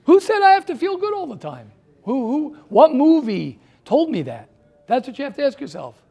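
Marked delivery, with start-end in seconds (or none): 0.0-4.5 building to a loud, higher-pitched challenge; rising outburst. Real, not acted. Teacher challenging his students with loud, rising questions. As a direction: push louder and higher with each question.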